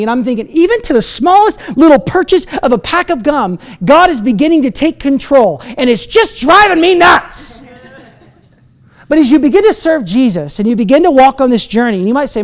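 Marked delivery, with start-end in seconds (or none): 5.8-7.6 high-pitched mock frustration; comic exasperation. Preacher tells of losing control over small purchases, comic exasperation. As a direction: throw up a hammed-up cry of exasperation.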